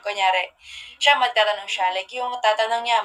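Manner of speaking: ordinary talk, nothing marked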